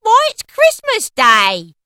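put-on high, loud, slow boy's shout; young boy. A narrator's reading. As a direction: call up as an amazed lad. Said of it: "replied the boy".

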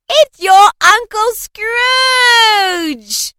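very high, loud, drawn-out shout; triumphant shout. A narrator's reading. Sister shouting the answer to Fred, high and slow. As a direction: crow the answer slowly. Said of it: "cried Fred".

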